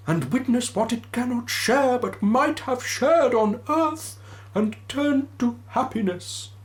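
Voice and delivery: Marley's ghost lamenting to Scrooge; mournful ghost. Acted. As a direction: wail your fate like a damned spirit.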